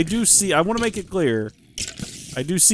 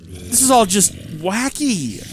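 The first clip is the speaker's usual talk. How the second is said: usual speaking voice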